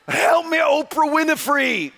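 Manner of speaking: high, loud, drawn-out plea; screaming for help. Retold or imitated. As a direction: yell it like a desperate man on fire. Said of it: "he's screaming".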